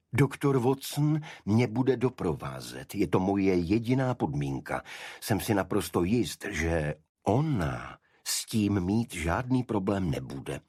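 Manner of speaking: firm, emphatic condition; insistent Holmes. A narrator's reading. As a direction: brook no objection. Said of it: "zdůraznil, nepřipouštějíc námitky". (důrazně, bez připuštění námitek)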